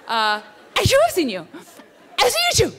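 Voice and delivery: laughing through the words, high and loud. Real, not acted. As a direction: giggle it out.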